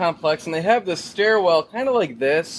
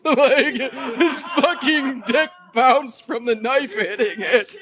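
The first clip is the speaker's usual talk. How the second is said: laughing; 4 semitones higher, much slower than usual. Real, not acted. Recalling the funny moment.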